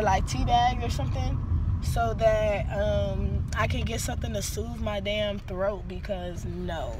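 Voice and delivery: normal talking voice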